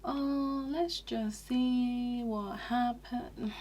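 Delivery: high, slow dismissive drawl; vague partner. Retold or imitated. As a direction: brush it off lazily. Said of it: "They're just really vague".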